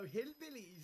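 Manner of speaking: slow mock Welsh drawl on "hillbillies"; Welsh accent. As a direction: copy the Welsh "hillbillies", slowed. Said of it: "said in a Welsh accent".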